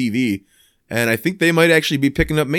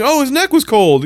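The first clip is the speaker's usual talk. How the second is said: imitated excuse, pitched high and louder; mock excuse; 12 semitones higher, 5 dB louder than usual. Caught choking the turkey, offers a high-pitched mock excuse. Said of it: "Jefferson's got his hands around".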